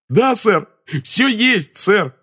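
very high, loud, eager answer; reply delightedly at the top of your range; joyful. A narrator's reading. Assistant confirms his notes to the minister, joyful. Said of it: "радостно откликнулся Перси".

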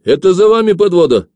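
high, loud, urgent call; shouting after someone. A narrator's reading. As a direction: shout to stop a runner. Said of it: "крикнул Ефим".

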